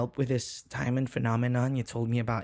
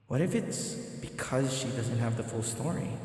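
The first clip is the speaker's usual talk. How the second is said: ordinary talk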